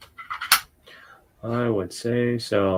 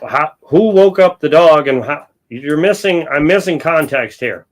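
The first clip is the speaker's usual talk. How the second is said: loud, high-pitched confusion; baffled outburst; 5 semitones higher, 7 dB louder than usual. Real, not acted. Host lost over who woke the dog, loudly baffled. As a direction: demand an explanation, pitched up and loud.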